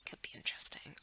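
"Could be interesting." as an unvoiced teasing whisper. Real, not acted. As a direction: breathe "Could be interesting." softly. Repeats the line whispered.

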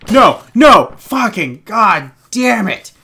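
loud "No, no", then slow dragged-out curse; real frustration boiling over; angry outburst. Real, not acted. Gamer spotted by enemies, loud frustrated curse.

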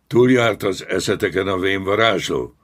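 furious commander, voice lifted high; angry bellow. A narrator's reading. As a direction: bellow at cowed underlings. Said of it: "ordította megszeppent zsoldosokra".

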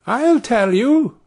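high, slow, drawn-out tiny voice calling up; little Tom pipes up at the passing men; Tom crying out. Acted. Tiny Tom calls out from the snail-shell to the passing thieves, high and drawn out. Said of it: "cried Tom".

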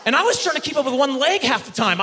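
usual speaking voice

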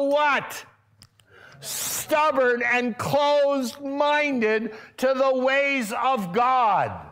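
preacher building to a righteous shout; fiery preaching. Acted. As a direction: thunder it from the pulpit about an angry Jesus. Preaching at full force.

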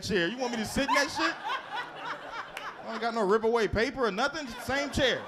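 high, incredulous griping at the nurse; indignant complaint. Retold or imitated. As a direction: play it outraged and disgusted.

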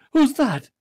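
high-pitched startled question; astonished cry. A narrator's reading. Nephew Fred wonders who is at the door, astonished cry. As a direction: ask it in surprise, pitch shooting up. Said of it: "cried Fred".

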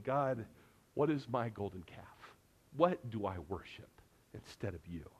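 a soft, low voice, as if praying alone; preacher quoting his own private prayer, hushed. Retold or imitated. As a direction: confide the question to God quietly.

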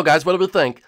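ordinary talk

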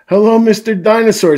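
high, loud hello to a toy; gleeful storybook character; delighted pig greeting. A narrator's reading. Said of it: "says Pig".

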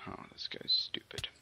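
hushed unvoiced whisper. Real, not acted. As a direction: whisper so he won't hear. Sneaking near enemy.

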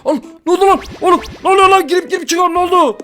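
high, loud bewildered yelps; real startled excitement, high and loud; startled panic. Real, not acted. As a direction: thrown into a strange room, blurt it out. Teleported, panicking at new place.

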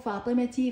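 فاطمتي said fatimati, final ti; voweled t ending. Shown as a demonstration. Teacher showing the spoken t, فاطمتي ending in ti. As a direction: end فاطمتي on a clear ti. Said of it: "هنا لفظتها تاء".

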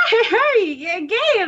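put-on high, laughing old-timer voice; cackling crazy old man; crazy old prospector. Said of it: "the crazy guy in Gravity Falls being like".

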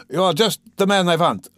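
high, loud eager cry; cried out. Acted. Raskolnikov grabbing the policeman's arm, crying out. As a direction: call out with relief. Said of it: "Raskolnikov cried".

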